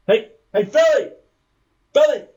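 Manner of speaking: loud, high shout for an assistant; calling out. Acted. Boss summons his assistant Billy, shouting. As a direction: yell to get someone's attention.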